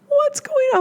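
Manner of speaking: surprised worried question to a crying client; startled concern. Retold or imitated. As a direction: ask it like you're caught off guard by tears. Reacting to a client starting to cry.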